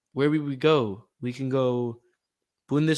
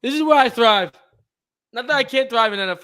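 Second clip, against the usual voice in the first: excited; 7 semitones higher, 7 dB louder than usual. Real, not acted. Eager about soccer.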